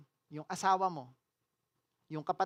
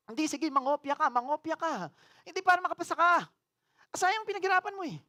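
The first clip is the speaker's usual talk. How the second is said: higher, quick coaxing tempter voice; tempting Satan; 7 semitones higher than usual. Acted. Preacher voicing Satan urging cheating and sulking, higher, quick and wheedling. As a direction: wheedle them into cheating, fast.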